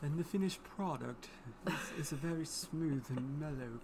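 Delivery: soft, snobbish English narrator. Pretend. Husband presenting the finished wine, mock snobbish English voice. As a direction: put on a snooty English accent, softly. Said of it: "graduate to a very snobbish English voice".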